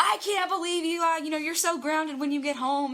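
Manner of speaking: high, loud retold scolding; mock angry dad. Dad reacting to daughter's secret trip, imitated angry scolding. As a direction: scold like an outraged parent on the phone. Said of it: "you're mad I'm here".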